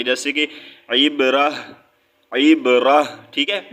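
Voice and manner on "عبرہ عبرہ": عبرہ ending in a breathed H; audible final H. Shown as a demonstration. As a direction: stop on عبرہ with an H from the chest. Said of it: "ہا کی آواز نکالیں گے". (عبرہ کے آخر میں ہا کی آواز)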